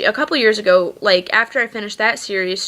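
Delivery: normal talking voice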